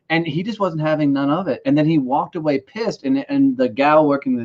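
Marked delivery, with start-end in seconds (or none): none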